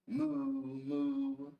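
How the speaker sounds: high, loud, drawn-out moo; mooing cow. Acted. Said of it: "He can sound like a cow".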